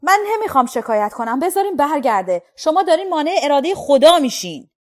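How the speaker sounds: a rising fervent shout at the police. A narrator's reading. Dave shouting at the police to let him go, loud and high. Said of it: "داد زد".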